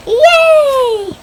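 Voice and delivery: high, loud, drawn-out cheer; mock cheer. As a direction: squeal it out in acted delight. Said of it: "And she's like".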